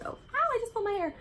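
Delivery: a quick real ouch of pain; sudden ouch. Real, not acted. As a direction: caught by surprise, pulling her own hair. Sudden pain mid-talk.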